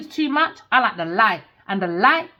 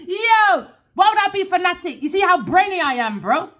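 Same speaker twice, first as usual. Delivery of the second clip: incredulous; 7 semitones higher than usual. Real, not acted. Reader scoffs at the fanatic claim, incredulous. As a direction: scoff at the idea. Mocking the accusation.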